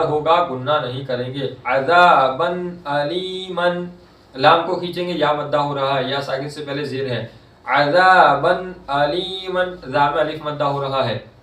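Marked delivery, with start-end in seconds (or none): 1.7-3.9 slow, drawn-out Quranic chanting; tajweed recitation. Shown as a demonstration. Recites example. 7.7-9.9 slow, drawn-out Quranic chanting; tajweed recitation. Shown as a demonstration. Recites example.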